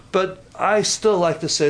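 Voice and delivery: ordinary talk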